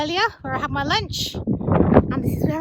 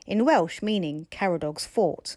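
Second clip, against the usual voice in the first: lower, calm voiceover; documentary narration; 6 semitones lower, 4 dB quieter than usual. A narrator's reading. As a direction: read it like a nature documentary.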